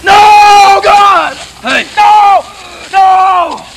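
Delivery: high, loud panicked cries; panicked shouting. Acted. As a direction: yell no in alarm. Sudden panicked outburst.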